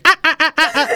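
high clucking cackle; imitated cackle. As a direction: mimic her chicken-like laugh. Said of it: "Sarah starts laughing again".

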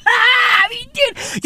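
high, laughing squeal of disbelief. Acted. Comedian imagining no way to charge, high squealed disbelief.

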